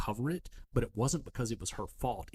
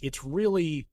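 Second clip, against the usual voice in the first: ordinary talk, nothing marked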